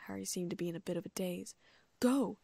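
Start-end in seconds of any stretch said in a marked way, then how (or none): 2.0-2.4 loud, sharp command; fierce. A narrator's reading. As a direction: bark the order fiercely. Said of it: "Hagrid said fiercely".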